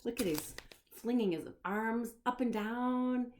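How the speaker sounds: ordinary delivery, nothing marked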